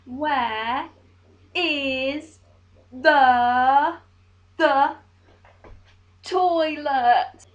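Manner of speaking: loud, slow tourist speech. Shown as a demonstration. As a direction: spell it out loudly to a foreigner. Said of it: "repeat your sentence only louder".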